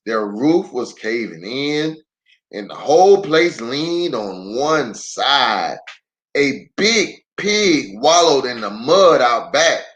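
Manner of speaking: ordinary delivery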